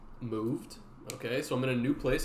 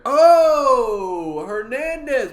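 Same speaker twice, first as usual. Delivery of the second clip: excited shout; 11 semitones higher, 16 dB louder, much slower than usual. Real, not acted. Calling out subscriber name.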